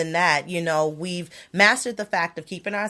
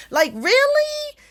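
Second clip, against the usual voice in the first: incredulous really; 4 dB louder, slower than usual. Real, not acted. Ranting about Trump losing.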